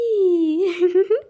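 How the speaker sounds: loud, high, drawn-out fake cry; mock child's wail. Child [name] is crying.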